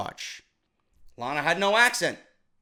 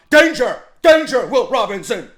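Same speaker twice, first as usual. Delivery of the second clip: loud, higher-pitched mock robot alarm; Lost in Space robot; 4 semitones higher, 7 dB louder than usual. Wrestling reviewer mocks Charlotte's promo delivery, robot impression. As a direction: call out a robot's danger warning, high and forceful. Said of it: "sounding like the fucking robot from Lost in Space".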